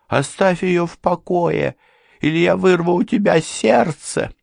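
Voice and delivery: high-pitched menace; high, tense warning, building slightly; threatening madman. A narrator's reading. Madman warns rival off his wife, high threat.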